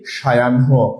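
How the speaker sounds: সায়াহ্ন as shayan-ho, low pitch; correct pronunciation. Shown as a demonstration. Teacher giving the answer, correct pronunciation. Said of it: "আমাদের উত্তরটা কি".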